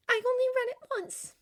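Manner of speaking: put-on high-pitched young woman; high, girlish chatter; girlish Glinda, explaining. A narrator's reading. Glinda's character voice.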